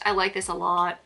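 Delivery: usual speaking voice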